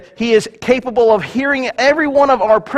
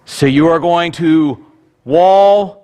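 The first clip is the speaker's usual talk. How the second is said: "Walmart" punched out loud after a slow lead-up; slow build, then hit "Walmart" hard; stress on Walmart; 3 dB louder, slower than usual. Shown as a demonstration. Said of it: "not possessive, and it's not plural".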